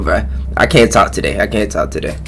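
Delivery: ordinary delivery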